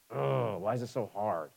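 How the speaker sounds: low, soft self-pitying complaint; a hint of a grumbling sulk; mock grumbling whine. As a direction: whine it under your breath like a sulking believer. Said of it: "And I grumble".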